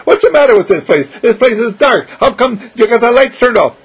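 high, loud silly bewilderment; mock confusion. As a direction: ham up being lost. Clown pretending it's dark.

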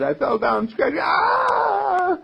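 put-on high crying yelp of a small boy who fell; hurt little boy. Little boy crying to his mommy about a scratch, high wail. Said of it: "the little boy who comes to his mommy".

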